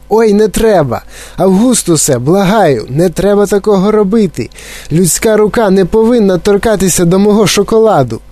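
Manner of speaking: high, urgent pleading exclamation; alarmed cry. A narrator's reading. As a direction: cry out in alarm, begging him to stop. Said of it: "то закричав". (стривожений вигук)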